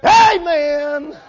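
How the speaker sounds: high, drawn-out put-on church cry; mock church talk. Preacher acting a hypocrite switching to church talk, mock pious cry. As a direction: imitate a showy churchgoer, high and stretched. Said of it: "put on the R B T talk".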